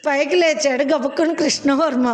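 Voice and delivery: ordinary delivery, nothing marked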